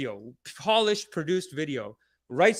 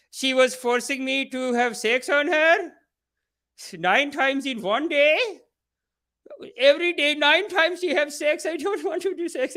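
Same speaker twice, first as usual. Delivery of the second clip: mock Indian accent; 8 semitones higher than usual. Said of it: "the guy has an Indian accent".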